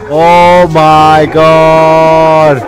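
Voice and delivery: very slow, loud stretched-out wail; long drawn-out cry. Real, not acted. Player watching the queen die, long loud wail. Dying in game.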